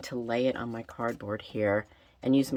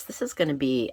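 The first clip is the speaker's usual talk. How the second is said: ordinary delivery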